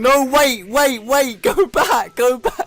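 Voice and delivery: high, loud, frantic pleading; panicked. Retold or imitated. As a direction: scramble to stop it, voice up high. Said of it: "people in the room going".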